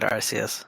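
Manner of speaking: Arceus with an S sound, AR-see-us; soft C in Arceus. Shown as a demonstration. Telling co-host their own habit, soft-C Arceus. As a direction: say Arceus with S, never K. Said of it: "I always said Arceus".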